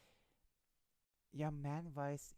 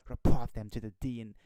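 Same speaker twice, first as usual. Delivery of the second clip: loud hammed-up urgent order; mock alarm; 16 dB louder than usual. Mock-reading the article aloud.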